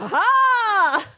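high, loud gloating ha ha; triumphant laugh. Real, not acted. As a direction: crow over the win. Gloats after winning.

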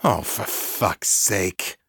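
slow, growled curse; understated irritated growl; exasperated growl. A narrator's reading. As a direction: growl it slowly, fed up. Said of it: "Braddock growled".

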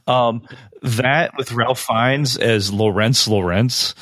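ordinary talk, nothing marked

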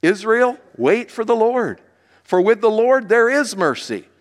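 declaimed psalm, pitch lifted at each new verse; scripture reading. Real, not acted. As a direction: read the psalm aloud for a congregation to follow. Reads the psalm aloud.

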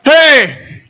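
yelling peanuts at the ballpark, high and loud; stadium vendor hawking. Retold or imitated. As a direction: bellow it across the stands. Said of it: "this was the guy's sale pitch".

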